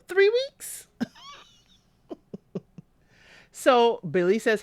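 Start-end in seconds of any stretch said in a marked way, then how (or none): 0.1-1.1 high incredulous squeak, imitating dad; mock-shocked father. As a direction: squeal it in disbelief. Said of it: "He's like".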